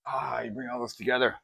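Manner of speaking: ordinary talk, nothing marked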